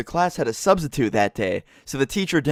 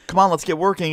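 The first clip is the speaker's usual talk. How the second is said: a mock strict teacher's snap, higher; lightly voiced scolding teacher; bossy teacher; 7 semitones higher than usual. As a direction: imitate the stern sub telling him to work. Strict substitute teacher scolding narrator.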